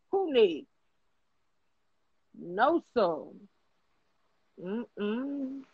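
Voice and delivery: normal talking voice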